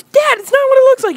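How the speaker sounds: very high, rushed girl imitation; pleading daughter. Daughter to dad who caught them, frantic mock plea. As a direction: plead fast in a mock squeal.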